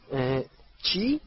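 drawn-out, puzzled question; baffled. Acted. Ron hears a foreign question, baffled. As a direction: stretch it out, not understanding.

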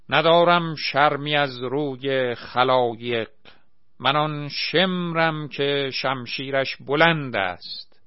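loud, slow pompous recital; villain declaiming. Acted. Asghar as Shemr declaiming to the crowd, loud slow bombast. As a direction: boom the verse like a stage tyrant.

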